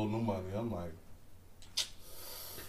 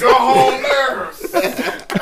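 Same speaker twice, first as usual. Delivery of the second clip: drawn-out mock heckle, higher; slow, raised-pitch mocking holler; heckling old men; 7 semitones higher, much slower than usual. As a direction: imitate old counter-protesters jeering. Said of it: "talking about".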